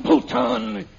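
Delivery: a loud, angry shout of his name. Acted. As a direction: call him out, furious. Threatens Bhutan to protect her.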